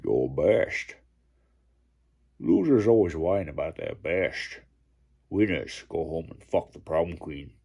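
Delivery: put-on low Sean Connery Scottish accent; Sean Connery impression. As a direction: lean into Connery's Scottish lilt. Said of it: "I'm gutting Sean Connery there".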